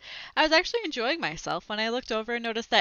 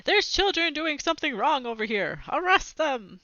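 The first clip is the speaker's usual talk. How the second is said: higher, indignant tattling call; tattling man; 6 semitones higher than usual. Acted. A lightly voiced man in a hat tattling to the librarian. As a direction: report the kids to the librarian, outraged. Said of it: "told on us".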